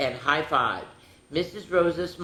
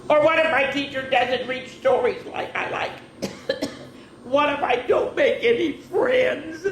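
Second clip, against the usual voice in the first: nervous worry; 10 semitones higher than usual. Acted. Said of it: "started to get a little nervous".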